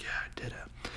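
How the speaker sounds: faint, whispered imitation; very soft mock relief; hushed triumph. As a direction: whisper it weakly. Harry exhausted, holding the stone.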